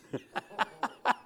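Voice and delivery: a quick high laugh at the memory; short laugh. Real, not acted. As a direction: laugh it off warmly.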